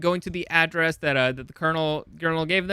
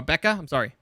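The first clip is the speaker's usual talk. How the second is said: ordinary talk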